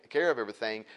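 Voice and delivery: ordinary delivery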